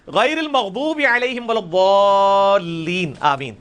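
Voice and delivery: slow chanted "غیر المغضوب علیہم ولا الضالین"; Quranic recitation. Shown as a demonstration. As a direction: recite the verse slowly.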